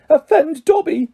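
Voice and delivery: high, choked elf cry; a lump in the throat, disbelieving. A narrator's reading. Elf choking out surprise at Harry's apology. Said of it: "choked the elf".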